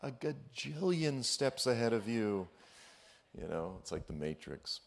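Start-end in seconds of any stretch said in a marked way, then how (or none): 0.0-2.5 teasing God. Retold or imitated. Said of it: "And the Lord's like".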